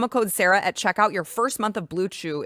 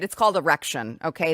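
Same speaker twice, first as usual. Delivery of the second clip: normal talking voice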